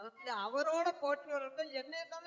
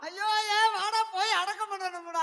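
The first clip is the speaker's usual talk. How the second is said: mock wailing; 2 semitones higher, 8 dB louder than usual. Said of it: "அழுதுகிட்டு இருக்க".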